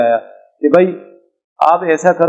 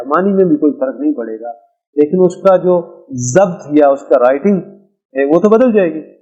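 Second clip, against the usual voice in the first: ordinary delivery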